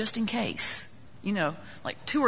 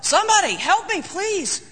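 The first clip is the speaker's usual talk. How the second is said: high, loud desperate cry for help; man dangling from a branch yells for help; yelling for help; 10 semitones higher, 3 dB louder than usual. Retold or imitated. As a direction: shout it out from the cliff edge. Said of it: "and he yells".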